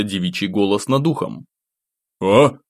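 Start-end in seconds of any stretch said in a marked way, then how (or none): 2.2-2.6 startled. A narrator's reading. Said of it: "вздрогнул я от неожиданности".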